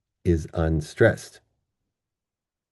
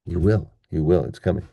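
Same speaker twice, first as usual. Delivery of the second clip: normal talking voice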